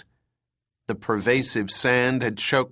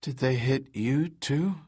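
weak injured woman; 2 semitones higher, 4 dB quieter than usual. Acted. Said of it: "Her voice was ragged and weak".

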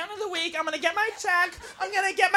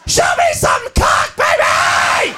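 drawn-out, hammed-up raunchy holler; wild scream; 7 semitones higher, 5 dB louder, much slower than usual. Suppressed girlfriend at the male strip club, wild scream. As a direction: scream it high and slow at the stage.

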